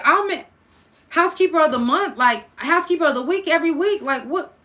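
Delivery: high, loud protest of disbelief; indignant outburst. Retold or imitated. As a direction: plead your case loud and high. Retold protest to bosses.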